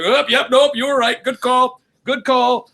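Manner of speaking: high eager backpedal; hammed-up nervous ump; mock appeasing umpire. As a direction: agree fast to calm a gunman. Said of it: "He jumped back immediately".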